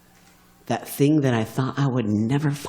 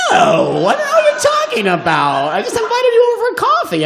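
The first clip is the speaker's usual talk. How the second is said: loud, high, laughing protest, rushing at the end; shrieking, amused refusal; indignant woman; 7 dB louder than usual. Retold or imitated. The woman refusing his advance, loud and high. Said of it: "And she said".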